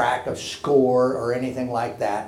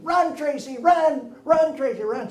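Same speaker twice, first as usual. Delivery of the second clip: much higher, louder chant of run Tracy; everybody shouting; 12 semitones higher, 4 dB louder than usual. Retold or imitated. Whole crowd chanting for Tracy to run, shouting. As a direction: cheer her around the bases. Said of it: "Everybody shouting".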